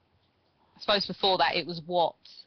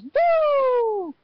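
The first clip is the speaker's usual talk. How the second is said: loud high falsetto woo; Ric Flair woo; 6 dB louder than usual. Retold or imitated. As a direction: belt out a long high woo. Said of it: "all going on throughout the arena".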